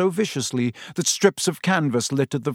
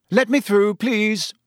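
self-important Percy; 8 semitones higher, 4 dB louder than usual. A narrator's reading. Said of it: "he came bustling importantly through the crowd".

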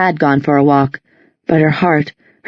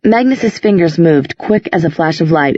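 ordinary delivery, nothing marked

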